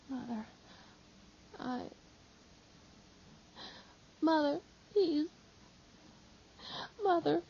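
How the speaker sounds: high, halting words through tears; broken sobbing. Acted. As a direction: choke on the words while weeping. John weeps, unable to answer.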